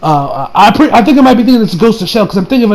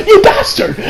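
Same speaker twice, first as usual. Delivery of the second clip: much higher furious yell; retold angry scream; 12 semitones higher, slower than usual. Reliving his reaction to the arm chop, a high furious yell. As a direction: yell it like you're in the anime. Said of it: "I screamed out".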